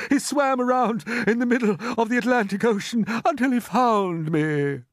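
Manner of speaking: high, loud overwrought relief; gasped amazement. A narrator's reading. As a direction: pour out the rescue tale breathlessly. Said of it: "gasped the centipede".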